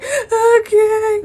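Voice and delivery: high, loud wailed assent; mock tearful okay. Beaten child answering mother, mock crying wail. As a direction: imitate a child bawling in agreement.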